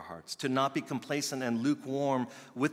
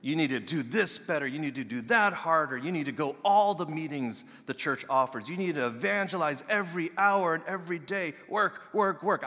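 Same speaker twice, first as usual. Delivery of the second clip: high, loud hammering orders; mock hard-driving preacher barking demands; demanding preacher; 7 semitones higher, 7 dB louder than usual. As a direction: drive them like a taskmaster, louder and higher. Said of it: "I probably would have preached that".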